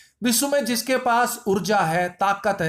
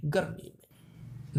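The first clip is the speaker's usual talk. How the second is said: whisper; 4 semitones lower, 10 dB quieter, much slower than usual. Acted. Punchline whispered aside.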